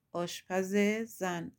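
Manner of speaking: ordinary delivery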